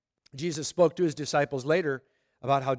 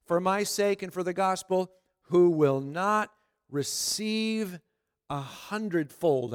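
slow, higher, measured reading with long pauses; scripture reading; 3 semitones higher, slower than usual. Acted. Preacher reading Jesus' answer to Peter, measured scripture reading. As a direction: read the verse out item by item, very slowly. Reads the verse aloud.

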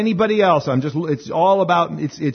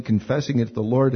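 ordinary talk, nothing marked

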